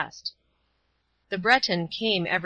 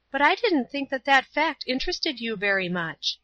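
put-on higher-pitched voice of a young girl; Paula; 6 semitones higher than usual. A narrator's reading. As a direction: lift the voice high for a girl's line.

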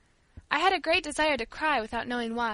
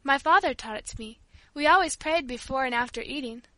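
usual speaking voice

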